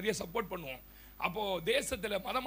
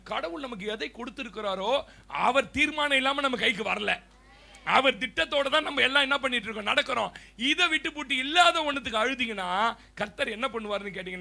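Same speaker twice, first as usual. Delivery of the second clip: preaching; 3 semitones higher, 8 dB louder than usual. Acted. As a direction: preach it at full force. Sermon rising again. (உரத்த பிரசங்க குரல்)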